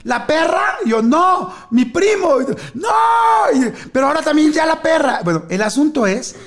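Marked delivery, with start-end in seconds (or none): 0.0-0.8 high surprised question. Retold or imitated. Parents asking. 1.0-5.2 high shouted reply. Retold or imitated. Kids yell back to the parents from the back: high excited shout.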